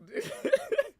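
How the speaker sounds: very high laughing shriek; high squeal of laughter. Real, not acted. Streamer recalling the cutscene, shrieking laugh. Cracking up again.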